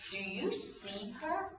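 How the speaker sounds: soft, slow, rising question; gentle question. Acted. Jesus asking Simon about the woman, gentle question. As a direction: ask it quietly, lingering on the words.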